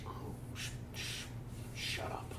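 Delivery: very soft, halting whisper; whispered catchphrase. Acted. Friend repeating the college saying, faintly whispered. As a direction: shush them in a tiny whisper.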